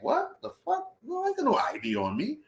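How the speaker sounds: high-pitched, acted-out disbelief; indignant protest. Protesting police demand for ID.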